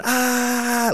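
long, high held falling scream; imitated scream. Acting out falling through the clouds, high drawn-out scream. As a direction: mimic screaming through a free fall. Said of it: "I'm screaming, I'm going".

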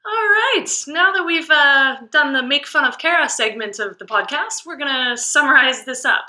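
high, loud host voice; energetic reset. Real, not acted. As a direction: bounce back loudly to host mode. Loud reset after teasing.

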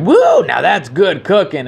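mock country whoop, pitched high; country accent. Imitating rapper burning a pig, whooping country accent. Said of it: "he had a country accent".

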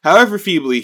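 hammed-up fussing king; mock alarmed king. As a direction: stop him quickly, alarmed. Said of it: "the king is like".

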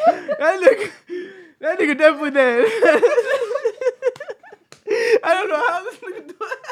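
high, loud laughing storytelling; can barely get the words out for laughing; laughing while telling. Real, not acted. Friend laughs telling how the horse died.

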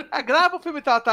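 high, loud whiny fan request; mock fan voice. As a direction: beg like a pushy viewer. Imitating a fan's request.

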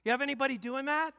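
normal talking voice

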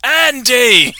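calling the naked Prime Minister in the lake. Retold or imitated. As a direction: holler his name to get attention. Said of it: "they go".

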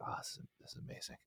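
soft fast hushed amazement; awed whisper. Retold or imitated. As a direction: whisper it, blown away. Playing Red Dead, awed.

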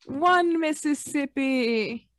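slow mock countdown; slow drawn-out count. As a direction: stretch every syllable slowly. Countdown, slowed.

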